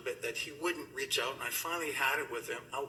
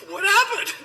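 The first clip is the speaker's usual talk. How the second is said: laughing; 12 dB louder than usual. Retold or imitated. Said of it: "I'm like".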